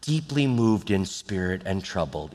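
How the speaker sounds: soft, low, gentle Bible reading; very nice reading. Shown as a demonstration. Showing how the congregation reads the verse, very nice and soft. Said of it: "we read it very nice".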